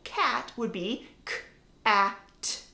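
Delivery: "cat" split into separate sounds k, a, t; sounds segmented. Shown as a demonstration. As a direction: sound out "k... a... t" one by one. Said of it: "break up a word into its sounds".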